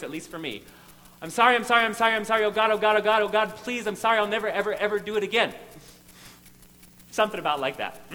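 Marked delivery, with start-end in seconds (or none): 1.2-5.5 loud, desperate begging apology; frantic pleading apology. Retold or imitated. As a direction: beg over and over in panic. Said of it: "it used to sound something like this".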